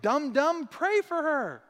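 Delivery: a playful divine nudge; Holy Spirit nudging. Retold or imitated. The Holy Spirit prodding the pastor to pray, high and teasing. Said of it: "the Holy Spirit was like".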